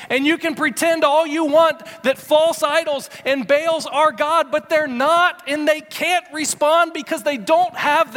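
high, loud preacher's climax, slowing on the last lines; preaching at full force. Acted. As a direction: proclaim it like a fiery preacher. Preaching climax, idols are not God.

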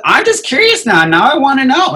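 very high, loud mock-mom pleading; imitated nosy mom. Mom pleading her curiosity, acted out.

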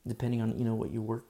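ordinary talk, nothing marked

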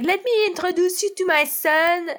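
high French lady voice; terrible French accent. Retold or imitated. French lady introduces her son, bad French accent. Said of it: "the worst French accent ever".

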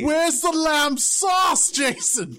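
high, slow, furious chef yell; angry shouting. Pretend. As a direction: bellow it like an enraged TV chef. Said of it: "played by Gordon Ramsay yelling".

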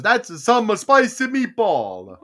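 light mock Italian accent, slow and loud. Recalling the spaghetti sauce commercial, mock Italian accent. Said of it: "my Italian accent".

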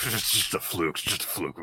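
put-on sulky boy muttering it off; muttered dismissal. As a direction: mutter it under your breath like a sore rival. Damien voiced dismissively.

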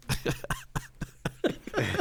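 imitated signature laugh. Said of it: "it was up close in the camera".

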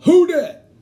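loud, high rallying holler; re-enacted yell. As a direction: belt it out to hail a fellow fan. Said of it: "So I just yell".

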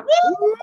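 high, loud "woo" cheer. Real, not acted. Host cheering as the guest is introduced, high loud woo. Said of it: "that wooed my voice a little bit".

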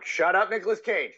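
high, snapped mock telling-off; playful outburst of annoyance; mock scolding. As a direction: shut him down in pretend anger. Pretend scolding of caged actor.

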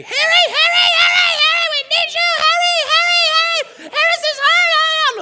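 very high, loud panicked calling of Harry; frantic calling. Retold or imitated. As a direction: scream for help across the house. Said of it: "My mom gets my dad and goes".

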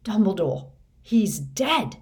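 loud, horrified announcement; appalled exclamation. A narrator's reading. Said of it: "it whispered".

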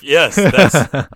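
laughing through a hurting side; painful laughter that catches. Real, not acted. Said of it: "it hurts to laugh".

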